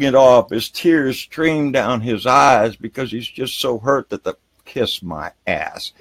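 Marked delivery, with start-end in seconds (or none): none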